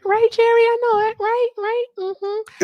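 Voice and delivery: squeaky high mock agreement; mock him in a squeaky high voice. Mocking Jerry's claim.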